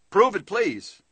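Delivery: a skeptic asking nicely for proof; polite challenge. Shown as a demonstration. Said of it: "hear the statement in your mind".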